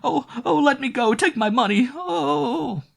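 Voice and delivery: very high, loud pleading screams; panicked shrieking. A narrator's reading. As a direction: beg the attacker in terror. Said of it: "she shrieked".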